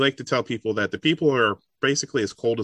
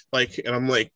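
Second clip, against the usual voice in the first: usual speaking voice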